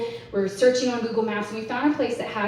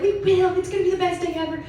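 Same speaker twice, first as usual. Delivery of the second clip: giddy excitement; 8 semitones higher than usual. Retold or imitated. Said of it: "we're like".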